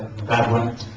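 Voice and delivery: usual speaking voice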